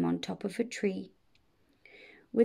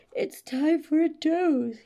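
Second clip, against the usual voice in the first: higher, drawn-out dragon voice; 8 semitones higher, 4 dB louder than usual. A narrator's reading. Dragon decides to doze, slow high voice.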